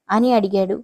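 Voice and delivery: usual speaking voice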